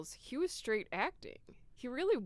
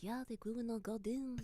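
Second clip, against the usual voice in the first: put-on soft French girlfriend voice; reply softly in a put-on French accent; 3 semitones higher, 7 dB quieter than usual. Girlfriend proud of the garden, soft French accent. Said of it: "French accent is".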